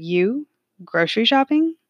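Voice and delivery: disbelieving rising question in an altered voice; judgmental grandmother. Retold or imitated. Grandmother doubting the granddaughter shops, mock judgmental. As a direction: let the disapproval drip through. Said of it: "There it was".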